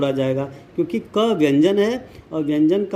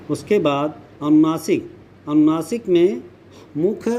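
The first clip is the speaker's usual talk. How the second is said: usual speaking voice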